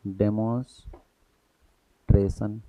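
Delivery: dictation-pace डेमोन्स्ट्रेशन; slow spelled-out word. Shown as a demonstration. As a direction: stretch डेमोन्स्ट्रेशन out for students to copy. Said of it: "दूसरा डेमोन्स्ट्रेशन".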